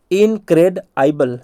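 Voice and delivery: slow, split इनक्रेडआइबल as in-cred-aibal; broken into parts. Shown as a demonstration. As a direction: spell it out in pieces. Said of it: "ऐसे तोड़ तोड़ के पढ़ना".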